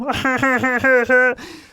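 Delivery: loud, high clowning cackle; weird goofy laugh. Pretend. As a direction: cut loose with a big silly laugh. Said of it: "I'll stop being weird".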